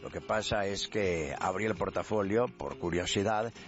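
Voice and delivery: low, slow Galician character; gallego preocupado. Acted. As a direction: explain low and slowly as the worried Galician. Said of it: "te noto como que estás muy preocupado". (gallego preocupado, grave y lento)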